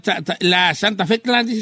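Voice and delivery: ordinary delivery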